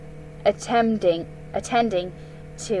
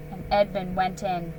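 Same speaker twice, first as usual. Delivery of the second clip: ordinary delivery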